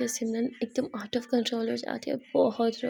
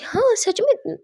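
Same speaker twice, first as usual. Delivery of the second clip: surprised; 11 semitones higher, 7 dB louder, slower than usual. Acted. Kiran surprised by adult pampers.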